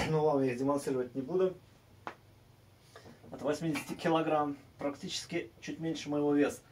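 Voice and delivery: quick, low throwaway remark; fast quiet aside. Real, not acted. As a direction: rattle it off under your breath. (быстрой скороговоркой)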